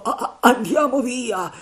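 high, loud frightened cry; panicked plea. A narrator's reading. Terrified pirate urging escape, panicked cry. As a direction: stammer out a desperate urge to flee.

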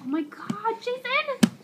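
high thrilled shriek; excited shriek. Real, not acted. Baby moves, calls husband.

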